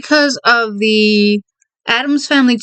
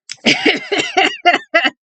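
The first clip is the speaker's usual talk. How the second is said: high giggle. Real, not acted. Giggling while holding in sneeze.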